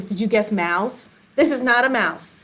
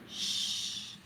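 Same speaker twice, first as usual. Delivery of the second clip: soft unvoiced shush; soft hushing sound; 19 dB quieter than usual. Shown as a demonstration. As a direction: hush them gently. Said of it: "Now we're very quiet".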